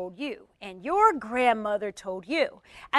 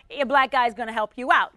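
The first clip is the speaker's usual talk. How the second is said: ordinary delivery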